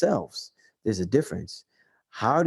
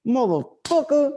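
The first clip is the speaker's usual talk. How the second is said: slow, higher, drawn-out mock gorilla-pimp growl; mock Trump thug voice; 10 semitones higher, slower than usual. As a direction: stretch each curse like a bully pimp. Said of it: "Donald Trump is like".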